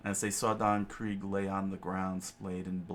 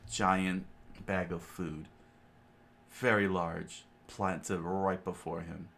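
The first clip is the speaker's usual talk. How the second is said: normal talking voice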